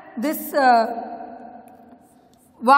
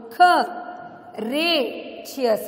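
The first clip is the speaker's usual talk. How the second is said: "k, re, cheers" as three detached beats; syllable by syllable; 4 dB quieter, slower than usual. Shown as a demonstration. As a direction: pause between each piece of "k, re, cheers". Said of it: "this also is three syllable".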